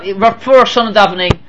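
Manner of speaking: ordinary talk, nothing marked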